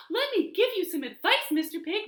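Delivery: put-on squeaky girly zebra showing off. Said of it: "she said".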